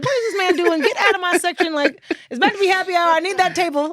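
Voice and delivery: high, loud, fast annoyed rant; fed up, wanting the table back; exasperated inner rant. Retold or imitated. Waitress fuming at a lingering customer, loud and fast. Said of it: "I was like".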